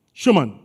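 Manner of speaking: Schumann with a falling tune, said in one go; Schumann said quick. Shown as a demonstration. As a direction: say Schumann fast and it works. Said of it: "So if I say it fast".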